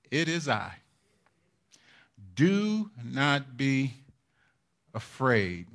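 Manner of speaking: soft and slow, calming frightened men; hushed slow comfort; quiet reassurance. Retold or imitated. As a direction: gently hush their fear, drawn out. Jesus quoted calming disciples.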